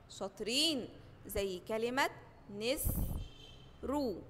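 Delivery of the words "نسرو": very slow "نسرو", split as nis, then ru; syllable by syllable. Shown as a demonstration. Teacher gives kasra example, "نسرو" slowly split into syllables. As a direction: say "نس", leave a gap, then "رو". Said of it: "لو تحتها كسرة زي كلمة".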